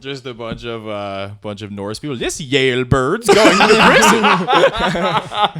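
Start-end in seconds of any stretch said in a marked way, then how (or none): 2.1-5.6 slow mock inmate voice. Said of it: "mock prisoner banter".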